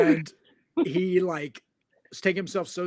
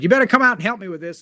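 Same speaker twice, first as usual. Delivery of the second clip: wife calling out; 4 semitones higher, 8 dB louder, much faster than usual. Retold or imitated. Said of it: "opens the tailgate and said".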